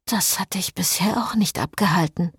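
a dry teasing whisper. Acted. Lovers' teasing exchange. (trocken neckend geflüstert)

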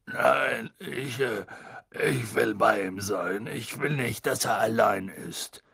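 slow, halting refusal; heavy-hearted, hesitant plea; distressed. Acted. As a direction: falter sadly through the words.

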